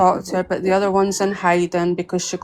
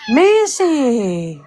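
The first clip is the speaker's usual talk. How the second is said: calling the cat; 6 semitones higher, 3 dB louder than usual. Real, not acted.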